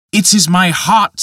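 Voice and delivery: put-on a young man crying out his pain; Peter, anguished. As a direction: blurt it out as if the heart aches. Peter voiced, distressed.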